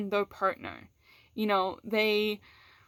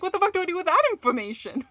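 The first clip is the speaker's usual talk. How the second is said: much higher, fast and rushed; exasperated disbelief; 9 semitones higher, 4 dB louder, much faster than usual. Retold or imitated. Speaker voices her own baffled reaction to a partner, high and exasperated. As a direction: blurt it out in baffled panic. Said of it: "And you're just like".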